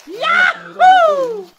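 high, loud happy whoop; excited cheer. Real, not acted. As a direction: whoop with delight. Cheering at the food.

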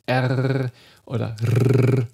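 R with a long trilled ending; R as a sustained consonant trill; rolled R. Shown as a demonstration. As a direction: roll the R hard and hold it. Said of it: "nicht gerollt".

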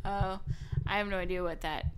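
usual speaking voice

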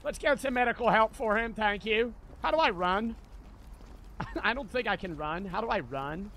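ordinary delivery

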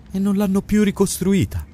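can't believe what he's hearing; disbelieving. A narrator's reading. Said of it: "Sembrava che non potesse crederci".

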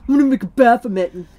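put-on loud, fast whiny teenager voice; hammed-up sulky teen, fast and loud; mock angsty teen. Parent mocking a sulky teen, fast whiny teen voice.

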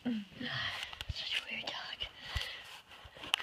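very slow, soft whisper. Real, not acted. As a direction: coo softly to the dog. Whispering to the dog.

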